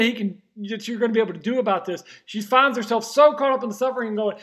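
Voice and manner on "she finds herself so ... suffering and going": normal talking voice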